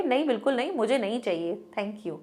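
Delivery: soft but final no, trailing to almost nothing; firm quiet refusal. Shown as a demonstration. Teacher showing a child how to refuse a stranger, softly firm. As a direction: turn it down gently but leave no opening. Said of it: "ऐसे बोलने से क्या होगा".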